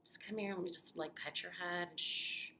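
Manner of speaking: soft, hushed coaxing with some whispering; gently calm a frightened man down; hushed, tender reassurance; soothing townsperson. Retold or imitated. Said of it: "would eventually just be like".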